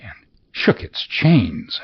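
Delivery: plain reading voice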